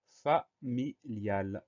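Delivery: FAMILIAL drawn out very slowly, fa-mi-lial; syllable by syllable. Shown as a demonstration. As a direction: break "familial" into three separate syllables, fa, mi, lial. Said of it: "Première syllabe".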